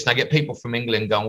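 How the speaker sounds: ordinary delivery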